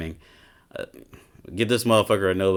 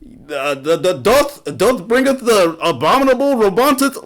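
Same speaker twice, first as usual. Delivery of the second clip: much higher, louder hammed-up olde English proclamation; mock medieval herald; 13 semitones higher, 6 dB louder than usual. Mocking Elden Ring fans with a much higher, louder hammed-up herald voice. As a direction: ham up a pompous fantasy crier. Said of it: "everybody wants these fucking Elden Ring ass".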